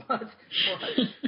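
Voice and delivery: high, breathless laughing "What? What?"; laughing mock shock. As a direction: play the stunned client while cracking up. Client stunned, teller laughs.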